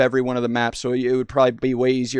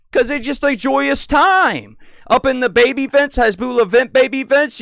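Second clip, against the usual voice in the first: high, loud and excited; excited gushing; 8 semitones higher, 4 dB louder than usual. Real, not acted. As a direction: gush about it with joy. Gushing about dancing checkpoint.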